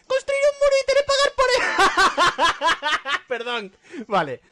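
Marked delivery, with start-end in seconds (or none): 0.1-3.2 high, loud, rapid squawk; mock captivating voice. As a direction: fire off a silly threat. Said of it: "Voz cautivadora".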